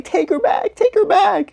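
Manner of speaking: high-pitched mock pleading; imitated frantic plea. [name] showing the baby to her mother, mock frantic plea.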